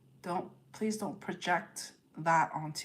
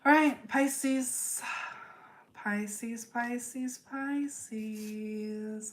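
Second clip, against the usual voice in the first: drawn-out call; 5 semitones higher, much slower than usual. Real, not acted. Dramatic call to next sign.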